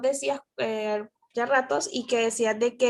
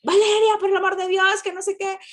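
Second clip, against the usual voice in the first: exasperated mock yell at her daughter, higher and louder; retold raised voice; 7 semitones higher, 4 dB louder than usual. Mother scolding her daughter over homework, exasperated raised voice. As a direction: imitate yourself losing patience, voice raised. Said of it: "¿Por qué me alzas la voz?".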